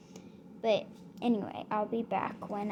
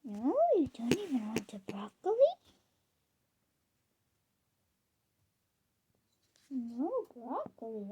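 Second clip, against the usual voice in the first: cooing disbelief; 4 dB louder than usual. Real, not acted. Pet rabbit refuses the broccoli.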